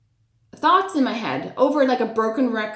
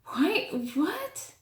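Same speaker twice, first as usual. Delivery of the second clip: higher, soft puzzled why, what; rising, barely voiced confusion; baffled whisper; 7 semitones higher, 8 dB quieter than usual. Caregiver hearing strange words in her head, soft and puzzled. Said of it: "I'm like".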